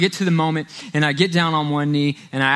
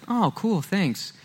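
underwhelmed; 4 semitones higher, 5 dB quieter, much slower than usual. Retold or imitated. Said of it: "if you want a reaction ... don't give it to me".

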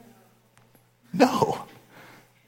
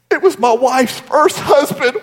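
higher, hammed-up sob; exaggerated blubbering lament; mock wailing; 5 semitones higher than usual. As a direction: burst into comic weeping for the punchline. Punchline: man wails again.

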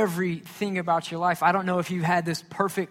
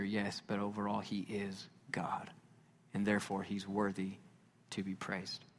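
much softer, lower, near whisper; hushed worship; 5 semitones lower, 10 dB quieter than usual. Acted. Pastor softly telling the congregation Jesus is worthy, in a hush. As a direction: draw them in with a hushed, intimate voice.

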